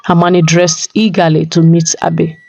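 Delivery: ordinary delivery, nothing marked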